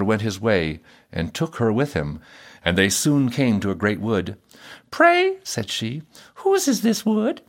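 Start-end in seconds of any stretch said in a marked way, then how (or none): 4.9-5.4 high-pitched princess voice. A narrator's reading. Princess questioning her fiddler husband, high-voiced. 6.4-7.4 high-pitched questioning princess voice. A narrator's reading. Princess asking the fiddler who owns the wood, high-voiced.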